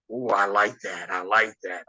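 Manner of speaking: a hint of a low, slow, relishing veteran; lightly voiced Kevin Sullivan. Veteran wrestler approving the match idea, mildly low and slow. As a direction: savour it low and slow like the old Taskmaster, only lightly put on.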